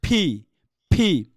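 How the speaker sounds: "ফি" twice, each with a puff; careful model of a puffed "ফি"; correct aspirated P. Shown as a demonstration. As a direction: pop the lips into "ফি". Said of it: "এটা সঠিক উচ্চারণ কি".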